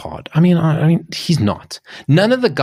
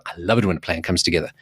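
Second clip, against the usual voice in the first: lower, softer, fast quoted catchphrase; a low, quiet, hurried hint of Hannibal; 6 semitones lower, 5 dB quieter, much faster than usual. Quoting the TV show's leader when the plan works, lower and softer. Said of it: "they go".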